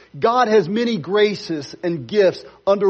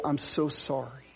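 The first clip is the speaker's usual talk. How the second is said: soft apology; 5 semitones lower, 7 dB quieter than usual. Pretend. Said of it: "will be ready to say".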